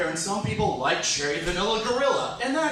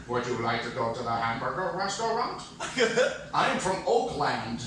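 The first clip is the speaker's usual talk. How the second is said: space alien; 6 semitones lower, 3 dB quieter than usual. Acted. Space alien trying to blend in with humanity, stilted voice. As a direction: speak like an alien posing as human. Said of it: "something a space alien says in order to blend in".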